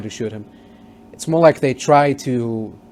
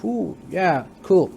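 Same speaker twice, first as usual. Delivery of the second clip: a higher, lightly mocked ooh of delight; impressed; 5 semitones higher, slower than usual. Maximus trying his armor, mock impressed. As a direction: admire the new armor, playfully. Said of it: "He's like".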